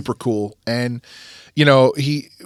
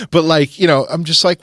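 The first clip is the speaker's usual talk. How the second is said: normal talking voice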